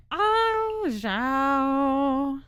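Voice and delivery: ordinary talk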